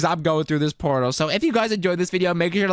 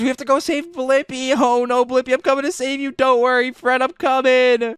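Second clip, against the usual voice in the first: ordinary talk, nothing marked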